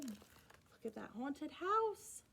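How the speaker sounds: plain reading voice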